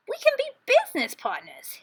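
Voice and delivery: high, bright-eyed trickster voice; cheery Hare. A narrator's reading. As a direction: Hare waking his neighbor with a sly grin. Hare's voice in story.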